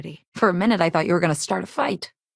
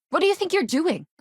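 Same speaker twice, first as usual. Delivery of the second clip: much higher, indignant cry; outraged challenge; 11 semitones higher than usual. Acted. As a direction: confront someone caught in the act. Challenging someone caught misbehaving.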